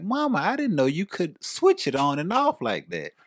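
high, loud, astonished retelling; amazed child. Retold or imitated. Child amazed at mom's switch, high excited voice. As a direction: marvel at it, pitched well up.

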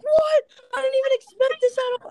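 very high, fast disbelief; high-pitched, rushed mock outrage; retold shock. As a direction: squeal it in hammed-up astonishment. Retelling own shock at prank.

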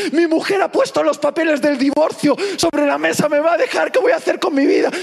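imitated high-pitched desperate wailing; mock tearful panic. Husband telling the pastor of divorce papers, mock frantic wail. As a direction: spill it out in hammed-up despair. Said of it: "me llamó el hombre llorando".

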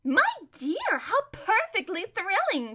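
high, gushing society-lady delight; drawn-out giddy excitement; thrilled. Acted. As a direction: gush over juicy gossip. Giddy friend thrilled by the news.

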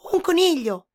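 high, loud quick answer; rabbit voice. A narrator's reading. Rabbit answering the boar, high and loud. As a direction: pipe up as the rabbit.

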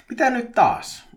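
high, loud exasperated cry; turhautunut huuto. A narrator's reading. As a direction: call out, fed up at another interruption. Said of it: "Petri huusi turhautuneena". (korkea turhautunut huudahdus)

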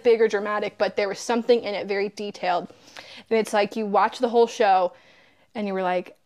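normal talking voice

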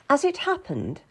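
ordinary delivery

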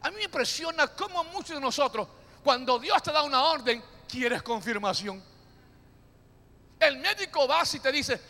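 ordinary talk, nothing marked

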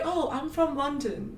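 a soft, quiet British accent imitation. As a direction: drop into a gentle British accent. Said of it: "That was me doing a British accent".

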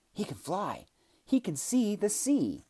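high, loud, slow triumphant cry; ecstatic reveal. A narrator's reading. Boojum twitching wildly at Kellen, crying out in delight. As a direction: build to a thrilled shout.

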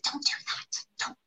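slow, unvoiced warning whisper; hushed, urgent little whisper. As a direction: whisper it like a tiny voice on someone's shoulder. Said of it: "whispering, like".

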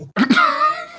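shrill burst of laughter; high squeaky laugh. Real, not acted.

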